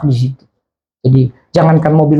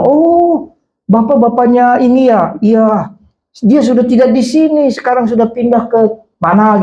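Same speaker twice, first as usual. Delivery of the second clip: higher-pitched voice on the phone; a light, raised telephone reply; lightly voiced phone answerer; 7 semitones higher, slower than usual. Voices the person who answered the phone. (suara penerima telepon, agak tinggi)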